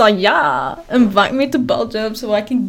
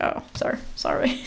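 small, hushed sorry, much softer; quiet apology; 10 dB quieter than usual. Retold or imitated. Replying to the venting caller, quiet retold apology. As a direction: murmur a retold apology under the breath. Acting out her meek reply.

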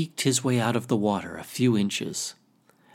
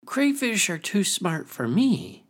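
loud voice; 9 semitones higher than usual. Acted. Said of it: "he said in a loud voice".

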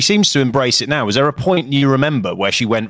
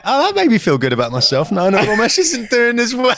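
mock smug through laughs; 10 semitones higher than usual. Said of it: "And I was like".

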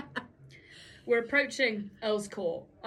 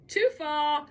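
mock exclaim; 5 semitones higher, 8 dB louder than usual. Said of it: "I was like".

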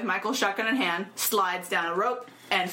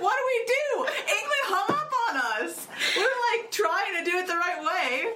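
high, laughing flustered cry; laughing mock panic; 10 semitones higher than usual. Germany asking what to do, laughing mock panic. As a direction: play a baffled nation, half laughing.

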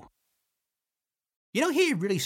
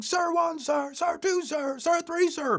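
high, raised mock recruit count; 13 semitones higher than usual. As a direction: count off like recruits answering the commander. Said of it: "we were all started counting".

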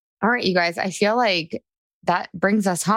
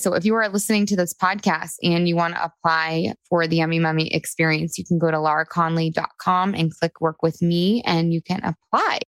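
ordinary talk

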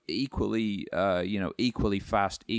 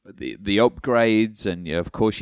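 usual speaking voice

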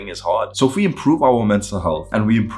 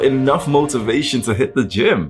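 movie trailer narration, slightly raised; dramatic narrator; 5 semitones higher than usual. A narrator's reading. Dramatic narration over music.

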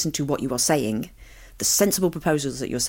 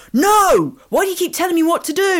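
put-on shrill high teenage yell, exasperated; teen outburst; 7 dB louder than usual. Teenager snapping at her mum, high indignant yell. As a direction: shriek it like a furious teenager.

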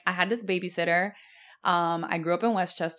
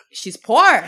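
sudden loud gasped she's poor; shocked realization; 3 semitones higher, 10 dB louder than usual. Retold or imitated. Telling a friend about the purse on the floor, shocked and loud. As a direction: say it as if shocked at the sight. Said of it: "I was like".

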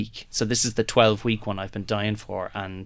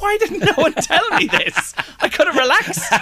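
much higher, louder, laughing mock outrage; laughing disbelief; 5 dB louder than usual. Retelling his reaction to the costume department, high laughing outrage. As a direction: squeal it through a laugh, half outraged. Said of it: "I was like".

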